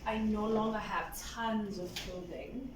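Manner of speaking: ordinary delivery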